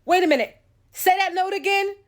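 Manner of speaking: high, loud, thrilled "wait a minute"; excited mother. Retold or imitated. Mother hearing harmony in her kids' cries, thrilled and loud. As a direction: she just heard harmony and can't believe it. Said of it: "my mother's like".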